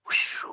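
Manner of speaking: long, airy whoosh; imitated whoosh. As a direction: make the sound of something flying past. Imitates something flying over his head.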